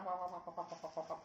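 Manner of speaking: rapid duck-like quacking gabble. Retold or imitated. Father mocks the child's mumbled words, gabbling like a duck. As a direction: imitate quick, repetitive duck chatter. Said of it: "kayak bebek gitu".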